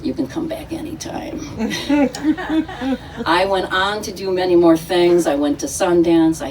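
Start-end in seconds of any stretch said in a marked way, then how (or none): 0.0-1.4 soft unvoiced whisper; hushed, breathy confidence. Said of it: "and he goes". 1.4-3.2 a high airy chuckle; breathy laugh. Real, not acted. Laughs after the whisper.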